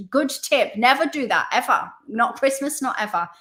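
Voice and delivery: ordinary talk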